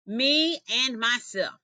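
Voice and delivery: slow, drawn-out boast; a proud lion roaring his boast; lightly voiced lion bellowing, slow; lion's roaring boast. A narrator's reading. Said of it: "He'd roar".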